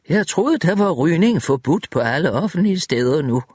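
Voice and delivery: lightly voiced old woman, mock stern; teasing old lady. A narrator's reading. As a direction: tease him lightly about smoking. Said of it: "sagde Elizabeth".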